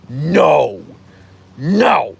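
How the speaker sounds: loud, drawn-out mock protest; indignant dog voice. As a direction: object like a jealous dog. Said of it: "he'll be like".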